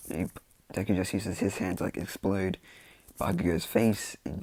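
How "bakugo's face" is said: ordinary delivery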